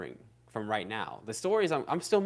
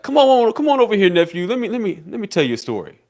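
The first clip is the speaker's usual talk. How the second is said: put-on old uncle voice; 3 semitones higher, 12 dB louder, faster than usual. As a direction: boom it out like Unc at the family cookout. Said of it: "Bro, you sound like Unc when".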